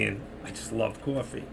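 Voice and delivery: normal talking voice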